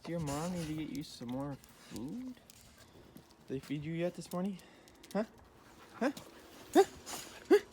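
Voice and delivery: high coaxing voice for the dogs; high pet-talk voice. Real, not acted. Farmer coaxing his dogs in a high voice. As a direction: coo at the pups.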